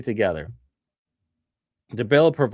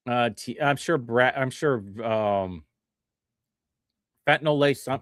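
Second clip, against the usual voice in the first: ordinary delivery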